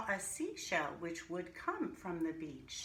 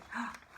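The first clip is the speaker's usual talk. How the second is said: surprised gasp; 3 semitones higher than usual. Acted. Surprise at the growing root.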